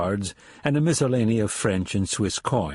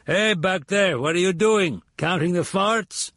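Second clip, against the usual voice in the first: put-on loud French-accented call; 8 semitones higher than usual. A narrator's reading. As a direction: shout back over the shoulder in a strong French accent. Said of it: "called out to the corporal in a loud voice, his marked German cut by a strong French accent".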